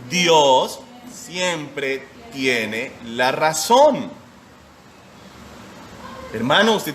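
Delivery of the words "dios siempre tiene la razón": each word drawn out very slowly, a final fall on razón; slow repeat. Shown as a demonstration. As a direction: say Dios siempre tiene la razón slowly for the congregation to repeat. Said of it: "Como dije".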